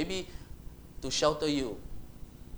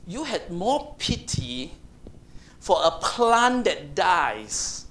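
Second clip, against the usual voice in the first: very slow, drawn out, louder at the end; slow rebuke; 6 dB louder, much slower than usual. Acted. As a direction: stretch every word to rebuke him. God rebuking Jonah slowly.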